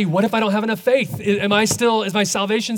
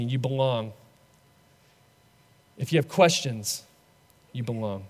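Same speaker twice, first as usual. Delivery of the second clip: softer and lower, each answer fading gently; tender Jesus; 6 semitones lower, 4 dB quieter than usual. Acted. Jesus answers the doubter, soft and tender. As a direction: reassure a frightened friend, almost a hush.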